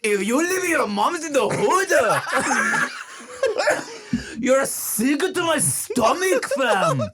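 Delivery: put-on high laughing Japanese accent slang; hammy Japanese accent, cracking up. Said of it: "but with a Japanese accent".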